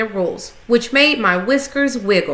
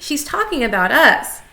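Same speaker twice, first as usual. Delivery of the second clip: squeaky; 5 semitones higher than usual. A narrator's reading. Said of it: "I squeaked".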